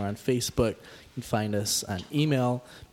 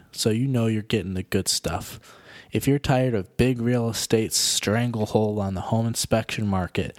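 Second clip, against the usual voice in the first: louder radio ad voice; ad read; 5 dB louder than usual. Acted. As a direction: pitch it like a radio commercial. Reads a sponsor ad.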